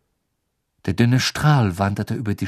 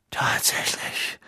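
slow, soft, whispery response; thoughtful Dumbledore; 5 dB quieter, much slower than usual. Acted. Dumbledore taking in Harry's news, slow and whispery. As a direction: draw it out under the breath. Dumbledore reacts while hurrying.

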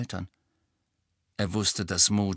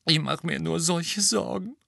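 tearful; 8 semitones higher than usual. A narrator's reading. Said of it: "wieder quollen ihr Tränen".

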